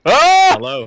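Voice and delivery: loud mic test. Real, not acted. Mic test, shouting greeting.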